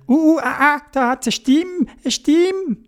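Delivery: high squeaky alarmed voice; frightened Dodo. Acted. Dodo hearing a voice in the tower. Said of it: "der Dodo gesagt".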